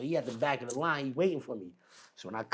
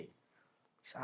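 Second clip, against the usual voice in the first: usual speaking voice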